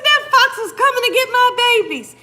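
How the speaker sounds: high, loud and fast, outraged; imitating indignant husband. Husband ranting that the fox came for the puppies, fast loud outrage. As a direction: rattle it off, protective and furious. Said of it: "My husband was mad".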